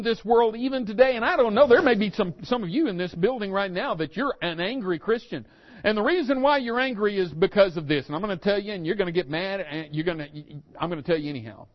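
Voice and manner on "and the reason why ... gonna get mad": ordinary talk, nothing marked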